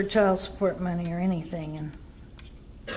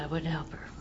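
whisper; 5 semitones lower, 13 dB quieter, faster than usual. Real, not acted. Quiet aside in AA share.

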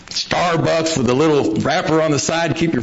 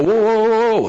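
alarmed whoa; 7 semitones higher than usual. Retold or imitated. Coffee poured to the brim.

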